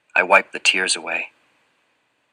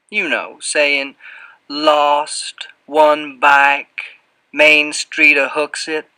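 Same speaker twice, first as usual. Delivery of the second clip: higher, drawn-out Southern character voice; heavy Southern accent; 6 semitones higher, 4 dB louder, slower than usual. A narrator's reading. Fat man hinting that the boy pay up, heavy Southern accent. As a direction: drip the words in a thick Southern drawl. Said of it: "His voice drips with a heavy Southern accent now".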